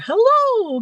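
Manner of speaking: high, loud singled-out hello; imitated greeting. Show host spots a newcomer swiping in, calling out excitedly.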